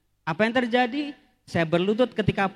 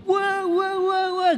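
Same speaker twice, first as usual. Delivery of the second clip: high, delighted gasps of wonder; mock crowd gasping at the passing pope; awed crowd cries; 13 semitones higher, slower than usual. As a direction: cheer like a crowd seeing the pope. Imitating crowd seeing the pope.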